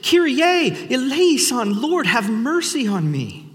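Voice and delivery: blind men crying out, voice pitched high; mock shout, high-pitched. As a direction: call out high and pleading, like crying out to Jesus. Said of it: "they shout at the top of their lungs".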